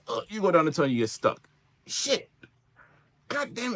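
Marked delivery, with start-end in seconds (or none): 1.9-3.7 sudden curse. Real, not acted. Stuck in tunnel, game.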